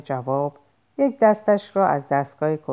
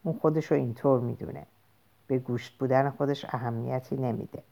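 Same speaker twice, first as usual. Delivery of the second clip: softer, faster private thought; inner thought; 4 semitones lower, 8 dB quieter than usual. A narrator's reading. Bernard privately judging Lenina, soft fast inner voice. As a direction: murmur it quickly inside your head. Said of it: "و در دل".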